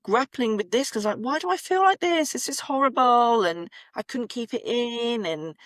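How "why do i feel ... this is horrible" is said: high-pitched exasperated wail; retold rage. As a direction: replay the frustrated inner outburst, pitched high. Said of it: "rage all the time".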